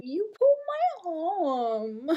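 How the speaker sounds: put-on high, slow, loud kid's wail; child's whine. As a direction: whine it like a hurt three-year-old. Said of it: "I remember this kid going".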